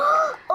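high startled gasp; sharp gasp. Real, not acted. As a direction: gasp in dismay. Sees damaged stone.

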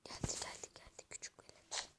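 breathless hushed whisper. Real, not acted. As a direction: whisper so they won't hear. Hiding as monster nears.

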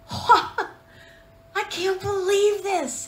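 retold giddy laugh through the words; laughing disbelief. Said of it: "and I remember saying".

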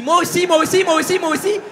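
high, loud desperate repeating; cling on like a drowning person; clingy me-too voice. Retold or imitated. Comedian mocking a clingy job-seeker, high and loud. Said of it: "qui dit".

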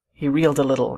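ordinary delivery, nothing marked

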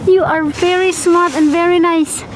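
high, sing-song praise for a pet; pet-talk voice. Real, not acted. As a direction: coo at the pet lovingly.

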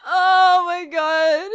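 wheezing through tears of laughter; crying with laughter. Real, not acted. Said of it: "can't stop".

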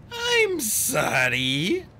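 high, drawn-out simpering apology; put-on high, fluttery apology. A narrator's reading. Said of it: "in a high, fluttery voice".

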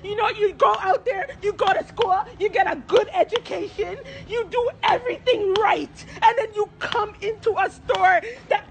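tearful, wounded outpouring; real crying, indignant and hurt. Real, not acted. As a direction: pour out the hurt through real sobs. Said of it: "the only reason I'm crying is because I'm hurt".

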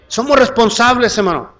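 loud, high-pitched sermon delivery; full-force preaching. Real, not acted. Preacher urges the church to announce the Gospel, full-force preaching. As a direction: proclaim it forcefully to the whole church.

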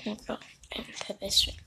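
quick hushed whisper; whisper, brother is asleep. Real, not acted. Brother sleeping nearby.

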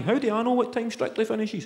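normal talking voice

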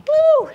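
high, loud "woo"; excited whoop. Real, not acted. Coach cheering on a workout class, high loud whoop.

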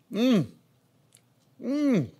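savoring mm while chewing. Real, not acted. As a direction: hum of enjoyment through food. Tasting the cooked steak.

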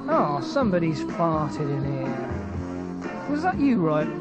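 ordinary delivery

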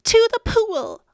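put-on loud, high boy's yell. A narrator's reading. Boy echoes the firemen, high excited yell. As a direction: shout it back with the pitch jumped up. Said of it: "I yelled".

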